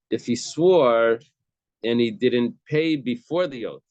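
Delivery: SWORE drawn out and loud, then a pause; stress on SWORE. Shown as a demonstration. Teacher showing how Abaye would read it, stress on swore. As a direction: stretch swore, then add the condition. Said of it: "he would read it like this".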